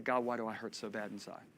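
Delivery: low, soft, hurting plea; quiet pained prayer. Retold or imitated. Man alone in his office asking God about pain, quiet pained prayer. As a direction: retell a private prayer, low and subdued. Retold anguished private prayer.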